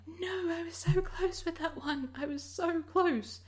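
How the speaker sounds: high, slow, drawn-out whiny wail; high drawn-out frustrated whine. Retold or imitated. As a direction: wail about almost making it. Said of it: "I'm like".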